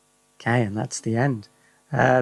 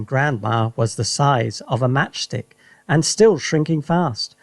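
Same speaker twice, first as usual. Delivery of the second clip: plain reading voice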